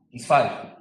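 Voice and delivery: ordinary talk, nothing marked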